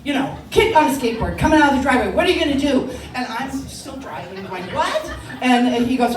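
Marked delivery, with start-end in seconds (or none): 0.0-2.9 loud, high alarmed shout; military dad barking. Retold or imitated. Dad to the learner driver on spotting a hazard, barking. 4.7-5.1 high, loud "what"; startled yelp. Retold or imitated. Flustered learner driver to dad, startled yelp. Flustered teen answering dad's alert.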